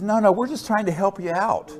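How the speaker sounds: imitating a smooth insider; mock reassurance. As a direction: brush it off like it's nothing. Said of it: "He says".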